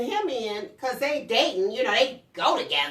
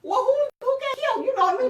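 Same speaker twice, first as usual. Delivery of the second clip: much higher, louder confused question; mock alarm; 6 dB louder, faster than usual. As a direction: pretend you think it's murder. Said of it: "I was like".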